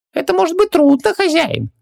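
very high squeaky genie, careful; high genie voice hedging warily; cautious genie. A narrator's reading. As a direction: tiptoe the warning in a tiny high voice. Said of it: "осторожно заметил Хубаксис".

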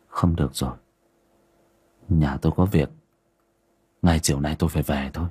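put-on low, dazed old man, last words rushed; lightly voiced old man blurting as if controlled. A narrator's reading.

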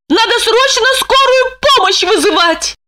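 high urgent cry; shouting. A narrator's reading. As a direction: call for help in panic. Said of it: "закричала".

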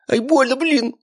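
high squeal of pain; pained yelp. A narrator's reading. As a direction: yelp as your wrist is twisted. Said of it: "зашипел прыщавый". (визгливо от боли)

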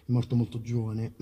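normal talking voice

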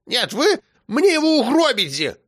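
put-on high, booming old woman; grandma thundering from the window. A narrator's reading. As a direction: thunder out the accusation. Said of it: "громыхала баба Сима".

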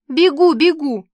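high, loud, hurried-to-please squeal. A narrator's reading. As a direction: call out shrilly from off to the side. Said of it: "заверещала сбоку". (визгливо, громко)

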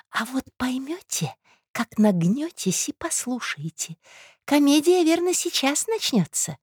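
slow, high, secretive promise; conspiratorial woman. Acted. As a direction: lure him in to eavesdrop.

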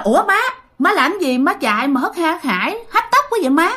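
high-pitched, puzzled call to mother; startled questioning. Retold or imitated. Aunt asks her hurrying mother why she runs, startled. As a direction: ask in surprise, pitch jumping up.

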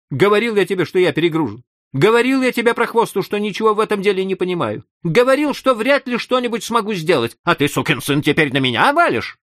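an angry official snapping back; angry outburst. Acted. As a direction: blow up at the boss. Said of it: "не выдержал и обозлился".

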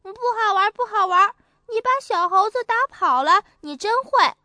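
put-on high, loud children's reproach; scolding children. A narrator's reading. Children scolding the boy, high and loud. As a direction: tell him off like upset kids. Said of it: "别的小朋友都说".

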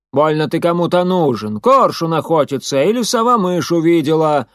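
loud, grumpy servant woman; scolding retort called across the house; quarrelsome shout. A narrator's reading. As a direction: shout back crossly in a character voice. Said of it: "сварливо крикнул в ответ женский голос".

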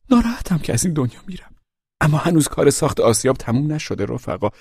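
ordinary delivery

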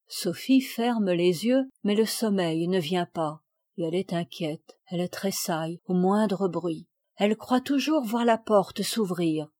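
ordinary delivery, nothing marked

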